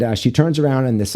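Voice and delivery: normal talking voice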